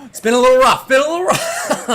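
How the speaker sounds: loud, high mock despair; mock anguish. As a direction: wail it over the joke. Mock anguish after the punchline.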